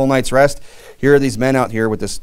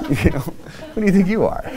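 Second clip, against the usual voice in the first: higher, laughing mock challenge; 7 semitones higher than usual. Real, not acted. Teasing his wife, laughing mock challenge. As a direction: tease through laughter.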